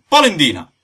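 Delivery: loud, high retort falling at the end; angry final jeer; taunting insult. Acted. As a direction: shout the nickname to win. Trading insults.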